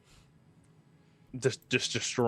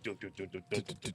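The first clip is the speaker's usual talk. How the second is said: dramatic sting hum; 11 dB quieter, much faster than usual. Retold or imitated. And it's like.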